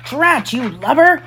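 high, cross pirate outburst; exclaiming pirate. A narrator's reading. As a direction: cry out as an annoyed pirate deckhand. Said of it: "cried Smee".

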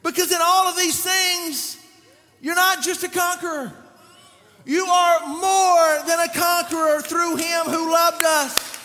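loud and high, declaring; full-force preaching. Acted. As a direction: lift the church with it.

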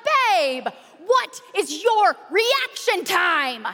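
high, slow, emphatic mock outrage; hammed-up exasperation, each word drawn out; exasperated scolding. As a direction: re-enact telling off a slow husband. Acted scolding of her slow husband.

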